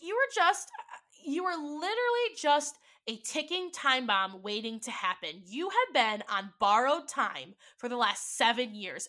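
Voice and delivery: loud, high heated accusation; furious, disbelieving shout; angry accusation. Retold or imitated. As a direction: throw it in his face. Confronting him after his shrug.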